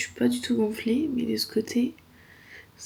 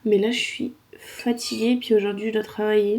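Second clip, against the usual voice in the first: ordinary talk, nothing marked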